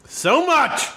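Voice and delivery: yelling into the mic. Real, not acted. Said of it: "You yelled it directly into the".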